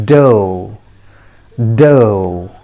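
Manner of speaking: dough as a clean "doe"; correct pronunciation. Shown as a demonstration. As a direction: model "dough" twice, clearly. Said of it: "The word is pronounced as".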